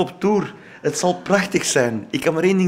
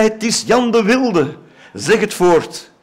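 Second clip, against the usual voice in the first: mock proclamation; 6 semitones higher, 4 dB louder, slower than usual. A narrator's reading. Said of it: "riep zij".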